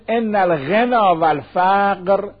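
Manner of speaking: scripture-reading voice, unhurried; slow hadith recitation. Acted. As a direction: intone the Arabic slowly and evenly. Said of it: "حدیث داریم".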